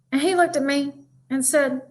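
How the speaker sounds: normal talking voice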